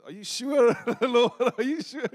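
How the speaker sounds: high, incredulous question through a laugh; acted-out amused double-check; laughing disbelief. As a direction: question God in comic doubt. Doubting God, laughing as he asks.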